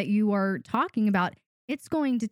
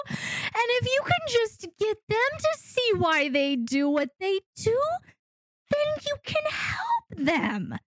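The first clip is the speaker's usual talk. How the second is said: mock pleading hope; 13 semitones higher than usual. Coach mocking an over-empathetic dominant with a high, tearful plea. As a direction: whine it like a hopeless rescuer.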